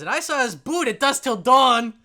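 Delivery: very loud, high chiming in; boisterous dwarf. Acted. Another guy pops up about the boot, boisterous. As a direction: shout out your sighting over the bar.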